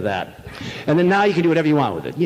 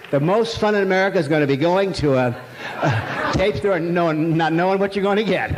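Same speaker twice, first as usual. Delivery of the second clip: usual speaking voice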